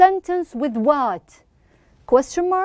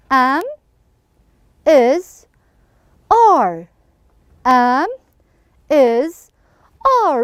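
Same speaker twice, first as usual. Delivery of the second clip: single words punched out loud with long gaps; loud, evenly spaced recitation; read-along drill; 6 dB louder, much slower than usual. Shown as a demonstration. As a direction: drill each word separately for the class. Teacher leads class reading verb forms.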